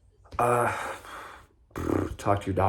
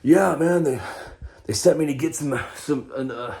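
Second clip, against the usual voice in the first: excuse-making voice; 6 semitones higher than usual. Retold or imitated. Making excuses to the cashier in a put-on voice. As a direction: sell the cover story in a goofy voice. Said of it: "I even had stories for, like".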